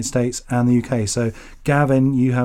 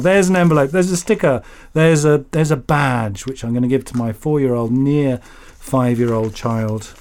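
ordinary talk, nothing marked

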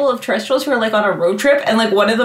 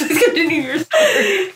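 laughing through the words; giggling, can barely get it out; 7 semitones higher, slower than usual. Real, not acted. Laughing while apologizing.

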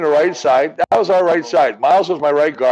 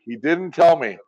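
ordinary talk, nothing marked

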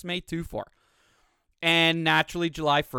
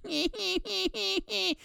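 high-pitched fake sobbing "hee-hee-hee"; mock whimpering; 3 dB quieter than usual. Mocking anxious-dog owner on Facebook, high fake whimper. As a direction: whimper in high, hammed-up bursts.

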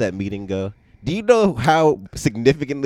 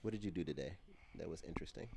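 gentle parent voice; 21 dB quieter than usual. Real, not acted. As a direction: ask softly like a patient dad. Dad interviewing child on mic.

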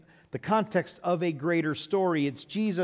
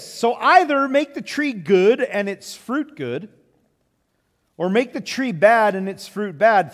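scripture reading; 5 dB louder than usual. Acted. Pastor reading Jesus' words to the standing church, solemn and proclaiming. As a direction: proclaim it to the congregation. Pastor reads Matthew 12 aloud.

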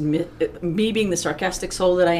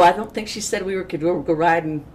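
usual speaking voice

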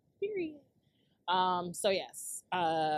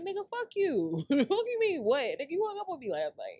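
high, animated outrage; exasperated, high-pitched retort; retold indignant comeback; 6 semitones higher than usual. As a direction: fire back in disbelief, pitch jumping up. Retelling an indignant reply to a text.

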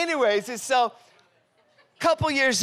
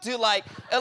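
ordinary delivery

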